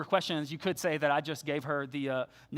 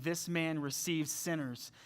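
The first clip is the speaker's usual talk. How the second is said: grumbling; 4 semitones lower, 9 dB quieter than usual. Retold or imitated. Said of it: "they grumble".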